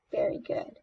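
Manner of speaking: soft unvoiced praise; whisper. Real, not acted. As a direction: whisper it warmly.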